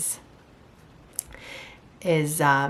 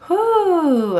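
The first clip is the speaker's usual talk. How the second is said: high, loud "whew" shaking off tears; emotional exhale; 12 semitones higher, 7 dB louder than usual. Real, not acted. Reader steadies herself after tearing up, loud relieved whew. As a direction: blow out the emotion with a big whew.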